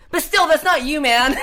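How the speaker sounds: loud, high mock protest with a laugh; stubborn insistence. Bar patron still doubting the singer, loud stubborn insistence. Stubborn punchline of acted argument.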